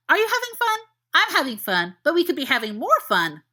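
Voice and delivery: high, eager puppy babble; excited puppy. Acted. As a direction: gush like an overjoyed dog. Dog Sirius greeting James.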